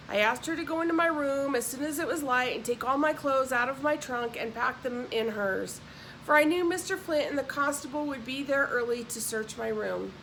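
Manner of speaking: ordinary delivery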